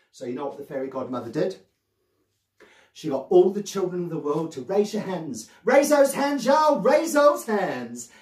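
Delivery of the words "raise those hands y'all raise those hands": loud, high rallying call. Pretend. Storyteller rallying all the children of the world, loud calling out. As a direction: call out to a whole crowd of children.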